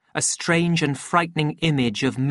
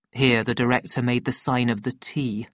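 ordinary delivery

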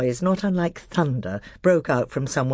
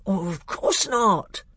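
slow, higher, affronted bear; very shocked; 8 semitones higher, slower than usual. A narrator's reading. Bear told not to suck his paws, very shocked. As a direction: draw it out, scandalised. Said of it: "in a very shocked voice".

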